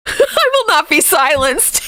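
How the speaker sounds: high, laughing mock proclamation; mock defiance. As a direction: declare it defiantly through laughter.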